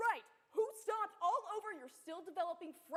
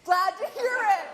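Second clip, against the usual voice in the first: booming prize announcer; 2 semitones higher, 15 dB louder than usual. Acted. Host booming at contestant.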